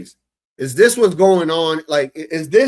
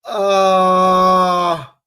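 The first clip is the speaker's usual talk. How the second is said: loud, long wail of exasperation; drawn-out exasperated moan; pained groan; 6 dB louder than usual. Real, not acted. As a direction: groan loudly and hold it out. Exasperated, getting a headache.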